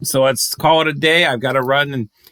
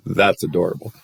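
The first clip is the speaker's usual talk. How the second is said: usual speaking voice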